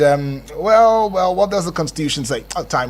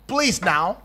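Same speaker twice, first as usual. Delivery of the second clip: ordinary delivery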